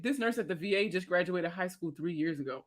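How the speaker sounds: ordinary talk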